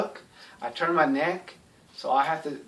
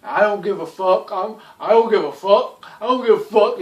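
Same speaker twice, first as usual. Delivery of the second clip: mocking a loudmouth, building louder and higher; imitating a belligerent show-off who insists he doesn't care; 4 semitones higher, 7 dB louder than usual. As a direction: ham up a defiant blowhard getting louder. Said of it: "always want to go off on others and tell people off".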